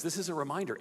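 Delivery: ordinary talk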